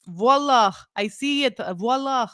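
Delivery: put-on playful Lebanese-accented exclaiming. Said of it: "saying it like a Lebanese person".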